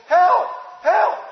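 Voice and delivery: high, loud cry of "Help!"; a bullied kid yelling for help; calling for help. Acted. Child calling out at school.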